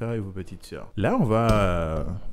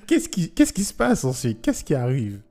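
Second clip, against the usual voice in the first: amused laughing question; 5 semitones higher, 5 dB louder than usual. Real, not acted. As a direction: question aiguë et plus forte, encore dans le rire. Amused co-host asks what happens. (voix très aiguë, rieuse et amusée)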